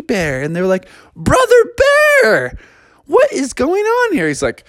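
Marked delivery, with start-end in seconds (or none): 1.2-4.3 very high astonished cry; surprised question. Acted. The frogs seeing Brother Bear's new family, high astonished cry. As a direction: gasp it in disbelief.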